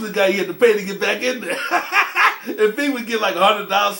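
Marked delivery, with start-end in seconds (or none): 1.6-2.4 real short high laugh; amused high chuckle. Real, not acted. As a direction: let a quick laugh burst out. Laughs at the money man.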